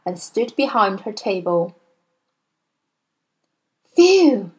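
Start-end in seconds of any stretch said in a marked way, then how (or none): 4.0-4.5 high, loud exhaled phew; big falling note of relief; relieved. A narrator's reading. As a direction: let out the tension in one word. Teacher relieved after headmistress leaves.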